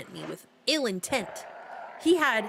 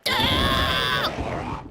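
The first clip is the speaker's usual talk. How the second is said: short startled shout; 10 semitones lower, 4 dB louder than usual. Real, not acted. Scream at jump scare.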